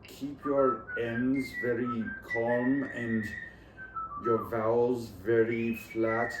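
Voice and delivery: slow, careful attempt at a Hindi accent; demonstrated Indian English accent, drawn out. Shown as a demonstration. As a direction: speak English like a Hindi speaker, very measured. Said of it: "you might sound kind of Hindi".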